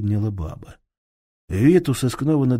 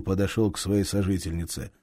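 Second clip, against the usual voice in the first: ordinary delivery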